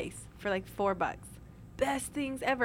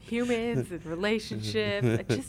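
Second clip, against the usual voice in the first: slow, drawn-out deadpan mockery; mock baffled mimicry; slower than usual. Host teasing co-host baffled by relationships, slow mock bafflement. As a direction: stretch the words out in mocking imitation.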